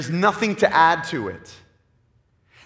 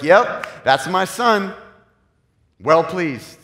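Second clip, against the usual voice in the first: a touch louder, proud fatherly approval; hammed-up proud dad, beaming; proud father's voice; 4 semitones higher, 7 dB louder, much slower than usual. God the Father proudly approving his son, a mild mock voice. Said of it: "the father's like".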